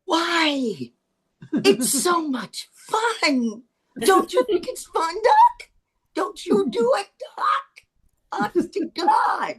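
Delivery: high, rising cries of disbelief; retold shocked outburst. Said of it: "I was shocked".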